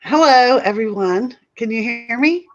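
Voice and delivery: loud nervous hello; jittery, a bit too loud. Real, not acted. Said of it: "I'm a little bit nervous here".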